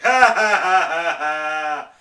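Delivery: big loud put-on belly laugh; mocking loud laugh. Imitating a person laughing at his cancelled trip, in a loud mocking laugh. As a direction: ham up someone laughing at you. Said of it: "one person actually laugh hardcore".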